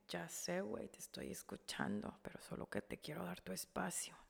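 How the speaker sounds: very soft, low retold voice; hushed reassurance. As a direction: reassure gently, barely audible. Brother's gentle reply, retold. (en voz muy baja, dando espacio)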